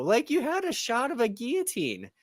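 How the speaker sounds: high-pitched protest, jumping loud on key words; incredulous rebuttal. Retold or imitated. Critic arguing back at the show's makers, incredulous rebuttal. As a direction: dispute it in exasperated disbelief.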